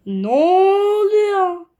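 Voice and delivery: long, high, loud drawn-out wail of disbelief; high, slow, loud cry of shock; stunned cry. Real, not acted. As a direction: cry it out as if you can't believe your eyes. Baffled at the game screen.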